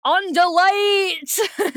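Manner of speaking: booming villain's battle cry, high and drawn out; theatrical villain bellow; Visser Three. Acted. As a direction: stretch it out like a hammed-up villain's command. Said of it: "since I've done my Visser Three voice".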